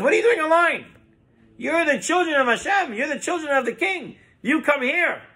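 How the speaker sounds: high-pitched, urgent exclamation; astonished minister. Acted. Acted astonished minister calling prince forward.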